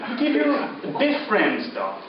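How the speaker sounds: ordinary talk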